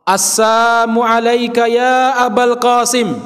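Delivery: "Assalamu" said as "Assamu", high and drawn out; dropped lam. Shown as a demonstration. Preacher mimics guests greeting the Prophet, "Assalamu" clipped to "Assamu". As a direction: chant "Assalamu" without its "la": "Assaamu". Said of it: "Kurang huruf lam".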